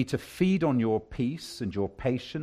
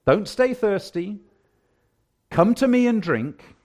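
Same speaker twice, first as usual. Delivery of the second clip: louder and higher, pleading invitation; preached invitation; 8 semitones higher, 8 dB louder than usual. Acted. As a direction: urge them to come and drink. Repeated invitation, preached at full force.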